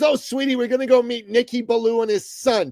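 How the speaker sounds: imitated mother in a high-pitched voice; placating mother. Mother trying to shut up her excited son, acted high-pitched placating. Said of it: "to try and shut him up".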